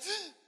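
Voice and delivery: high startled "ah" of sudden relief; surprised gasp. Retold or imitated. Healed woman gasps as her pain suddenly leaves.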